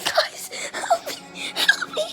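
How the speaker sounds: squeaky, very high whimpering plea; mock cry for help. Real, not acted. Begging her viewers for help under puppies, a squeaky whimper. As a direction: squeak for help, half crying. Puppy attack.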